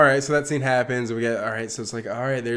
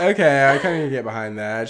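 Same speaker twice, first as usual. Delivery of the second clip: laughing loud okay, then settling; amused exasperation, laughing; laughing at a movie character; laughing disbelief; 5 dB louder than usual. Retold or imitated.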